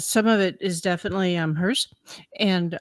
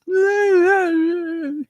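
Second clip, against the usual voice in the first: long high blubbering wail; hammed-up crying noise; mock sobbing wail; 11 semitones higher, 4 dB louder than usual. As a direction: imitate a messy, wordless bawl. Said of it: "I'm sobbing. I'm a snot-nosed mess".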